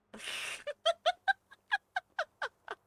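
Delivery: shrieking burst of laughter; loud high laugh. Real, not acted. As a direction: cackle up high. Laughs after cheeky joke.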